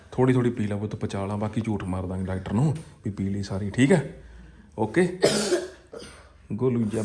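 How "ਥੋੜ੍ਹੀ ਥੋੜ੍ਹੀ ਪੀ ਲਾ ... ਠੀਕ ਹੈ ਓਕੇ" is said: tender coaxing. Real, not acted. Father softly promising his son they'll fib to the doctor. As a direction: lower and softer, a conspiratorial promise.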